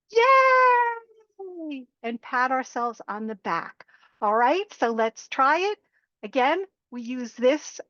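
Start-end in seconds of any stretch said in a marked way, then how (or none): 0.1-1.9 high, loud yay; a big celebratory whoop; cheering yay in a high voice. Shown as a demonstration. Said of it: "congratulate yourself".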